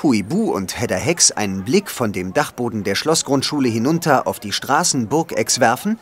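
ordinary delivery, nothing marked

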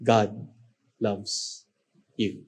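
soft and low, slow reassurance; quiet pastoral blessing to closed eyes; tender, hushed. Shown as a demonstration. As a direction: lay each word gently on their hearts. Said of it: "And for the last time".

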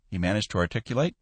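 plain reading voice

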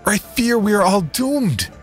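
high, strained plea over a noisy crowd. Acted. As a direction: beg them for peace over the din. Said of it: "spoke up over the din".

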